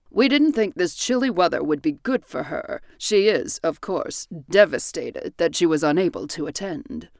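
a hint of a grave older father; lightly voiced, measured father; father explaining. A narrator's reading. Father excusing the mother's absence, lightly voiced grave man.

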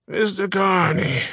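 high, slow, hoarse greeting; a hint of an old shopkeeper's cough. A narrator's reading. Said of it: "he coughed".

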